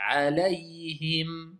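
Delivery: Alayhim slowed right down, with the ayn, la, yi and hi all sounded; every letter clear. Shown as a demonstration. The teacher shows all letters sounded in Alayhim, slowly. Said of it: "Moi tout a été prononcé".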